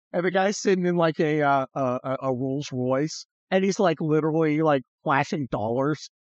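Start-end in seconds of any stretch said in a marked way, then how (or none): none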